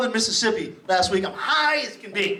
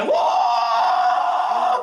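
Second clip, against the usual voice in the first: party whoop; 3 dB louder than usual. Pretend. Drunk party guy shouting.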